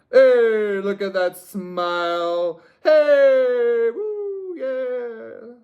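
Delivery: loud, high talk-show welcome; talk show host. Pretend. Reader welcoming pile three like a loud talk-show host. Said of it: "a talk show kind of energy".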